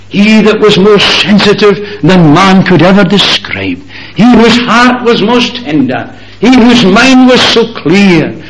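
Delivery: high, loud climbing pulpit cry; soaring sermon voice; impassioned preaching. Acted. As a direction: preach it out at full force. Preacher rising to full force.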